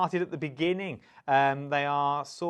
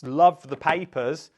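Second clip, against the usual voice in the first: "Love" drawn out, loud and slow; stress on love; 7 dB louder, slower than usual. Shown as a demonstration. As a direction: lean hard on "Love" and stretch it. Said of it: "Emphasis on the word loved".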